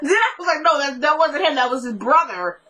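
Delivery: high-pitched, laughing through the words. Real, not acted. Recapping her reaction to the paper boy's brother, laughing. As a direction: start high and helpless with laughter, settling as you go. Recalling own reaction while cracking up.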